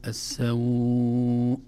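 very slow السوء, aw held six beats; long leen madd. Shown as a demonstration. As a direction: draw the glide of السوء out to full length. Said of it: "هكذا يسمى مد اللين".

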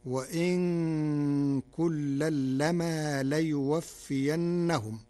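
slow recited Quran phrase with light nun and mim; slow Quranic recitation; Warsh reading. Shown as a demonstration. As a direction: recite وإن كلا لما ليوفينهم slowly, وإن with a light unstressed nun. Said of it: "فتصير الجملة".

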